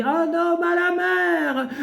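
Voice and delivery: man-overboard cry. A narrator's reading. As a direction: cry it out across the deck, voice soaring high. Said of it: "sur le pont crie".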